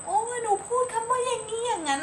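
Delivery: put-on very high-pitched cooing to a toddler; gushing parent. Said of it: "เราจะต้องตอบสนองแบบ". (แม่ชมลูกเสียงสูงอย่างตื่นเต้น)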